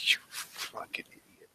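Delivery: soft unvoiced aside; muttered insult. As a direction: drop the voice to a hushed jab. Muttered insult after laugh.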